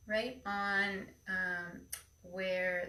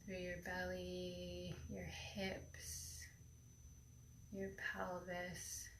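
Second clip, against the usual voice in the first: airy breathy mystical healer; 9 dB quieter than usual. Acted. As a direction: breathe each word into calm stillness. Leads a guided meditation.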